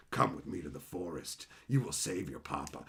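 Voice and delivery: low, soft, sinister coaxing; creepy coaxing. Retold or imitated. Father coaxing his kids into the forest, low and creepy. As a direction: lure them in like a storybook villain.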